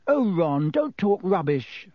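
high, exasperated retort; snapping. A narrator's reading. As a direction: snap impatiently at a friend. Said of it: "snapped Hermione".

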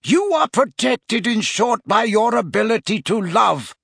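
lightly acted old wizard, fervent; Dumbledore. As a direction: sum it up with slow emphasis at first. Voices Dumbledore's emphatic conclusion.

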